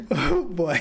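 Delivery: high giggling laughter; cracking up high; laughing hard. Real, not acted. Laughing at robot voice.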